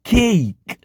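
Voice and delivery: કેઈક with a slight i glide after the e; long A. Shown as a demonstration. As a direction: say કેઈક as kei-k, falling. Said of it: "ઈનો ઉચ્ચાર થોડો આવશે".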